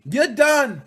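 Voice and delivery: high, loud gloating shouts at Nintendo; triumphant gloating. Real, not acted. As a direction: rub it in, jeering and cheering. Celebrating poll win over Nintendo.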